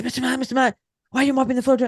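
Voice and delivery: a fussing nurse, lightly voiced; imitating the staff.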